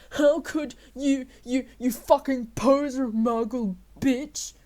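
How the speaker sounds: loud slow furious yell; shouting. A narrator's reading. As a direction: drag out the insult at full volume. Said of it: "he shouted".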